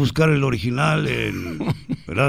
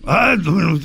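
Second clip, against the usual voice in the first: ordinary delivery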